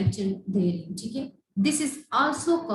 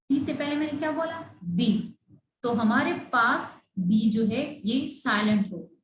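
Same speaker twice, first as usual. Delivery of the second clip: usual speaking voice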